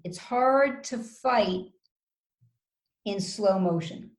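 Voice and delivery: drawn-out, slow words; slow motion. Shown as a demonstration. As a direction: stretch each word with a long pause. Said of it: "you speak slow and calm".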